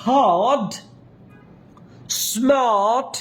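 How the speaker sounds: হার্ড, স্মার্ট with a long open "aa"; wide-open long vowel. Shown as a demonstration. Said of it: "এটা একটু মুখটা বেশি খুলবে".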